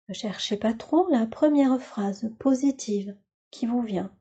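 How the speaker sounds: ordinary delivery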